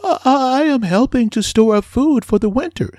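a diligent little worker explaining; busy earnest ant. Acted. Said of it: "said the ant".

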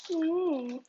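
usual speaking voice

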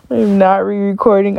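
usual speaking voice

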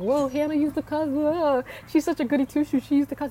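astonished onlookers. Retold or imitated. Everybody reacting to her past cussing, mock-astonished and high-pitched. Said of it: "everybody's like".

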